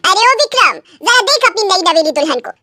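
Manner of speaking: ordinary delivery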